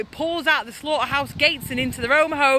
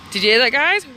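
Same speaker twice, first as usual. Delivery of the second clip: usual speaking voice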